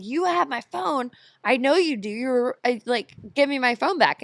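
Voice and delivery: high-pitched, insistent acted confrontation; retold demand. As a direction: act out insisting to a thief. Retelling the repeated demand to thief.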